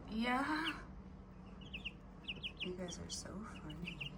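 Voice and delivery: high, hushed cooing to the hens; soft pet talk. Acted. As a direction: greet them gently like babies. Softly greets the hens.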